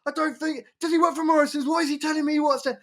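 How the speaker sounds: very high, strained mock outrage; imitated angry guy. Angry driver asks if the man works for Morrison's, mock outraged. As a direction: rant way up high, mimicking an indignant driver. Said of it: "the angry guy goes".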